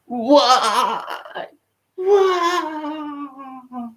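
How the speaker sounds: high-pitched mock wail, long drawn out; hammed-up crying "why", stretched out; dramatic crying why. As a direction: wail it high like a melodramatic crier. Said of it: "It was a dude crying".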